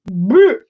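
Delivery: loud revolted retch-noise; disgusted blech. Real, not acted. Reacting to saliva coating food, loud disgusted noise. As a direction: burst out a blech of revulsion.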